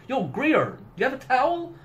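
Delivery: a hint of a casual soldier asking for a towel; soldier impression. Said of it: "my impression of those two characters".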